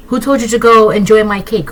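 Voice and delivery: normal talking voice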